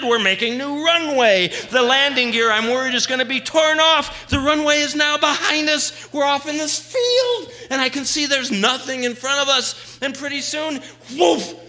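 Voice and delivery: loud, high-pitched thrilling narration; excited storytelling. Retold or imitated. Teller recounts the overloaded plane's takeoff, loud and high-pitched. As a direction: tell the takeoff loudly, at a high pitch, as if living it.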